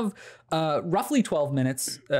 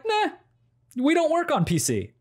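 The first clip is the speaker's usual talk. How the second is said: high, dismissive voice for the game; voicing the game; 13 semitones higher than usual. The game refusing to run on PC, mock smug high voice. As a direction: brush him off like smug software. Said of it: "it was just like".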